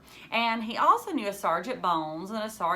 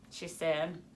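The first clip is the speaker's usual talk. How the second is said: usual speaking voice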